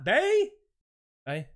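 ordinary talk, nothing marked

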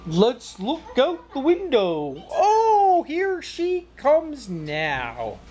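very slow wolf, leaping up on the exclamation; excited Wolfie. Acted. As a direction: draw it out as you spot Grandma. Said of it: "And Wolfie said".

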